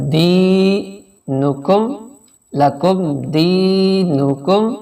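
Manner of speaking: دینکم with the دی drawn out slowly; slow recitation, دی held long; stretched دی. Shown as a demonstration. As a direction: lengthen the ee in دینکم. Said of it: "دی پہ تھوڑا سا آواز کو کھینچ لیں گے".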